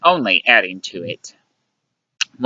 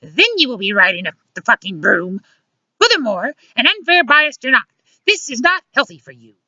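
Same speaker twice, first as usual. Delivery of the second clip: high-pitched scolding woman; indignant comeback; 13 semitones higher than usual. A narrator's reading. Luciana objects to being called a witch, indignant comeback. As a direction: threaten him in a high, offended voice.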